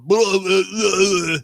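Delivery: loud fake-Russian babble; mock Russian gibberish. Bluffer babbling at the door, mock Russian gibberish. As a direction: blurt nonsense as pretend Russian.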